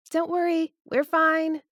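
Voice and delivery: high, loud shout upstairs; shouting back. Acted. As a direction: shout up the stairs. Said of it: "she shouts back up".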